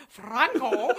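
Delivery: put-on loud, amused Scottish accent; mocking Scottish accent. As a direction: tease with a Scottish take on FRANCO. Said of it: "So you sound Scottish".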